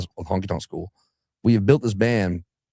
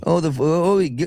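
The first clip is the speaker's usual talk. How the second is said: put-on Australian accent; 7 semitones higher than usual. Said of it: "they call the little boats".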